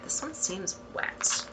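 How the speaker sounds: muttered whispered aside. Real, not acted. Checking a wax bar, whispering an aside. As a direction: say it under your breath.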